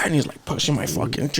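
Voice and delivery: ordinary talk, nothing marked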